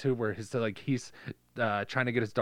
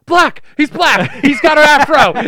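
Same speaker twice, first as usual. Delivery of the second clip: high, loud panicked yelling; frantic shout; 13 semitones higher, 17 dB louder than usual. Retold or imitated. Girl under the bed yelling what she sees. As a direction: yell it out in a panic. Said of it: "she's like".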